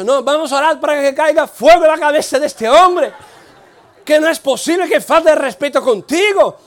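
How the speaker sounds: arenga fuerte y aguda, escandalizada; indignación exaltada imitada. Retold or imitated. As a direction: imitar al compañero clamando indignado. Imita a Eduardo indignado pidiendo fuego. (indignación exaltada, imitada)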